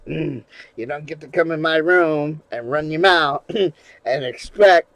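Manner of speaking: ordinary talk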